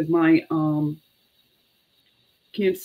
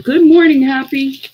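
cheery greeting; 7 semitones higher, 10 dB louder than usual. Real, not acted. Greeting viewer joining live.

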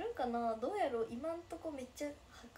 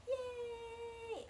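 happy cheer; 11 semitones higher, much slower than usual. Real, not acted. Cheering at fan's news.

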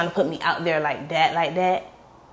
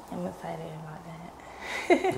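whispered; 10 dB quieter, slower than usual. Real, not acted. Quietly admitting her excitement.

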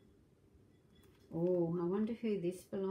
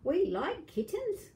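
lightly voiced boy, higher and slow; happy little boy; 9 semitones higher than usual. Grandma reading, boy welcomes kitten, lightly voiced boy. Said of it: "said the boy".

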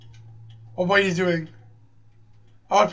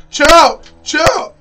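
high, urgent shout; panicked yelling; 5 semitones higher, 7 dB louder, slower than usual. Real, not acted. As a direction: yell at an attacker to back off. Panicking under attack in game.

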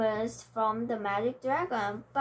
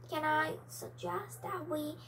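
quieter, timid try; soft, timid voice; 5 semitones higher, 6 dB quieter than usual. Acted. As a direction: ask meekly, barely heard. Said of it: "said the tortoise one more time".

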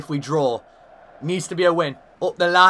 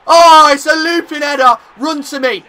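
screaming with joy, far higher and louder; ecstatic shout; 10 semitones higher, 14 dB louder than usual. Real, not acted. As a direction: roar the goal in delight. Celebrating a goal.

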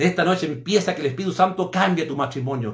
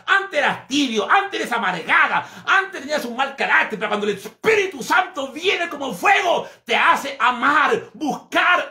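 preaching at full force; 8 semitones higher than usual. Acted. Preacher shouting to the church about the Spirit's fire. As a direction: build the fire, shout it to the congregation.

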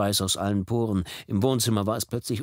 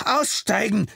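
high loud shouted order; roared command; 13 semitones higher, 5 dB louder, slower than usual. Acted. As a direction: bellow at the driver to get out. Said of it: "brüllte er".

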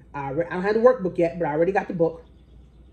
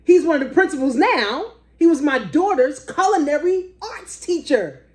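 usual speaking voice

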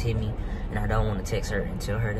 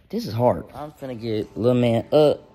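normal talking voice

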